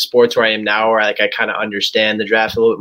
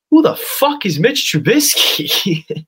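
slow, higher, incredulous mock outrage; baffled outburst; 8 semitones higher, slower than usual. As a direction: drag it out like you've never heard the name. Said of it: "And I go".

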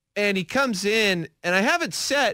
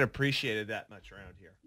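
off mic mumble; 7 semitones lower, 10 dB quieter than usual. Real, not acted.

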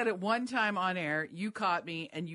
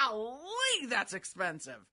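imitating correspondent's golly; 3 semitones higher, slower than usual. Mimicking him at the grocery store, exaggerated golly. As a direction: mimic his astonishment at a price. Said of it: "Literally says it just like that".

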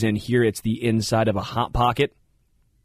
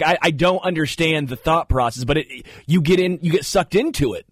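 usual speaking voice

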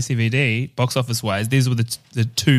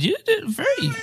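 squeaky voice. Acted. Squeaky mock praise.

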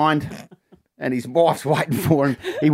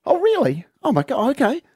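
much higher, sweetly surprised wife; wife suddenly won over by the excuse; appeased wife; 10 semitones higher than usual. Acted. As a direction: melt into gushing relief. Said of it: "And she goes".

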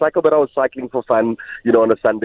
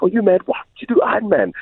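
teasing; 11 semitones higher than usual. Retold or imitated. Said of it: "I always used to tease her".

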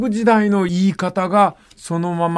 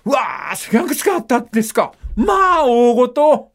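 high, fast excited telling with a mock shriek in Kumamoto dialect; 7 semitones higher than usual. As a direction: act out a dramatic Kumamoto retelling. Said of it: "ちゃんと悲鳴も入れる".

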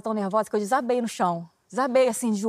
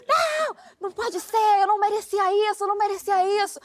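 retold yelling; 8 semitones higher than usual. Taxi driver shouts his despair to himself, high anguished wail. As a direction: wail like a betrayed man falling apart. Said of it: "E ele gritava".

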